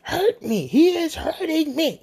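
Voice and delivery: high, whiny put-on old lady voice; mock upset old lady. Mocking the irate customer at the register, whiny and high.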